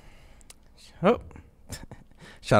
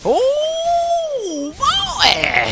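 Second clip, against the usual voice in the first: excited. Real, not acted. Tense moment in match.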